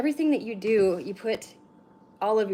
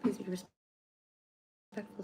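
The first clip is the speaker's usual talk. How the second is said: formal announcement; 6 dB quieter, slower than usual. Acted. Chat rules read out.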